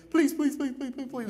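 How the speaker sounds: put-on high, whiny child's pleading please; kid begging. Pastor mimics his kid begging for candy, high and whiny. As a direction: whine it like a kid after candy. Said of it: "my kid's asking for candy".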